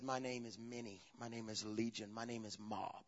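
low, very soft voice; hushed demon reply. A narrator's reading. Possessed man answers Jesus with his name, hushed and low. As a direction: let the name come out low and quiet.

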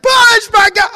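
high, loud strained cry of push; mock pained yell. Preacher mocking men with kidney stones, a strained yell.

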